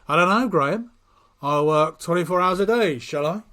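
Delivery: loud, exaggerated sarcastic retort. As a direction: lay the sarcasm on thick and loud. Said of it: "Bob's being sarcastic".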